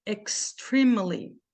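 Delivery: "extremely" slowly, first E short, "eks-TREEM-uh-lee"; slow pronunciation model. Shown as a demonstration. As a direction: draw out "extremely" with a long I in the stressed syllable. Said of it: "Essa palavra vai ficar assim".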